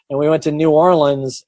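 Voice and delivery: normal talking voice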